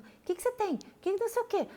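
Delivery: high, fussy imitation of the family pestering; mock nagging questions. Mother mimicking her family's pestering questions, high mock fuss. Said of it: "todo mundo".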